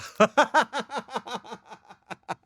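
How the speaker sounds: long, high staccato laugh; high cackle. Acted. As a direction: cackle on after the defeat, trailing away. Laughs after game over.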